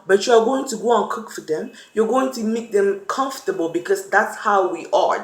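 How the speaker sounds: ordinary talk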